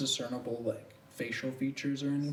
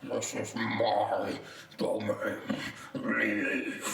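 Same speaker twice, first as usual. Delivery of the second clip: lower, slow menacing vine monster; deep dark voice; 4 semitones lower, much slower than usual. Acted. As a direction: growl it out as an ominous creature. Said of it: "in a deep, dark voice".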